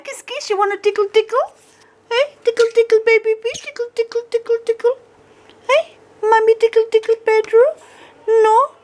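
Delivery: high, sing-song pet talk; doting owner cooing to her parrot. Real, not acted.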